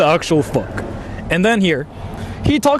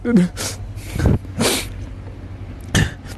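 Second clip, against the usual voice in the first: silent laugh; 6 dB quieter than usual. Real, not acted. Quiet laugh.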